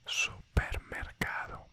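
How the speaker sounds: supermercado said slowly in Spanish, soo-per-mer-KAH-doh; slow whispered supermercado, Spanish vowels; Spanish word. Shown as a demonstration. Teacher giving the Spanish word. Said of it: "Let's make a resume about this".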